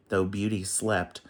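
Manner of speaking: usual speaking voice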